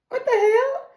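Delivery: high-pitched outraged what the hell; mock shocked mayor; outraged high yelp. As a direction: squeal it in shocked disbelief.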